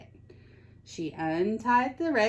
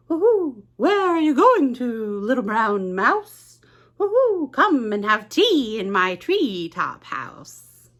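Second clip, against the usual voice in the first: high, louder hooting owl voice; an owl calling down sweetly; 5 semitones higher, 5 dB louder than usual. Acted. Owl to the mouse, high hooting invitation.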